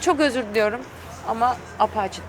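normal talking voice

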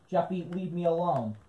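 ordinary talk

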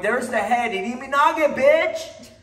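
hammed-up ego yell; mock aggressive shout. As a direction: bark the throw at them like a bully. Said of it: "Without going".

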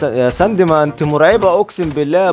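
normal talking voice

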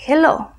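hello with the h sounded like a Spanish jota; "hello" as a model word, h as in jota. Shown as a demonstration. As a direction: say "hello" with an audible J-like h at the start. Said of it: "va a tener un sonido de J".

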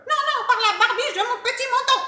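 high, loud, fast piglet squeal of defiance; shrieky little pig voice; little pig refusing. Acted.

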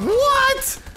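sudden high startled cry; startled shout. Real, not acted. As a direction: jump out of your skin.

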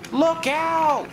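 high, loud warning yell; alarmed cry. A narrator's reading. James spotting a lorry blocking his path, crying out. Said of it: "he cried".

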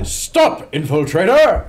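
high, loud command; full-voiced in-character yell; shout at the top of the lungs. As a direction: shout it to halt someone fleeing. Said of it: "Did shout at the top of my lungs".

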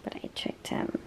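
playful whispered aside; sneaky stage whisper to the camera. Acted. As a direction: whisper it like a secret joke. Teasing boyfriend on camera.

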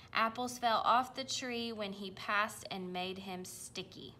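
ordinary delivery, nothing marked

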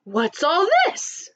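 slow, lightly voiced puzzled father; puzzled Papa. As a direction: wonder aloud, slowly, scratching your head. Papa puzzled, scratching his head.

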